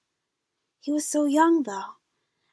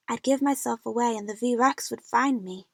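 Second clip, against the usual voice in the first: usual speaking voice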